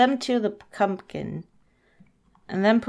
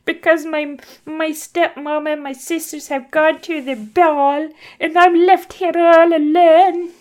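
high put-on plaintive voice, swelling louder; Cinderella; 7 semitones higher, 7 dB louder than usual. Cinderella explaining her tears to old lady, high put-on voice. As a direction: wail it higher and louder, hammed up. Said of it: "why I'm using that voice".

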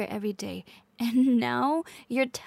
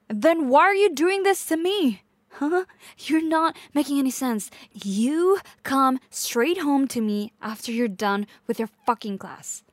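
higher, louder, furious demand; angry outburst; 3 semitones higher, 6 dB louder than usual. Acted. Girlfriend confronting partner who may leave, angry outburst. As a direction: raise your voice and lay down the law. Acted quarrel, partner threatened with leaving.

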